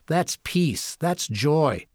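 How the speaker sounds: ordinary talk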